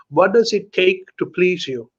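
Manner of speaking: normal talking voice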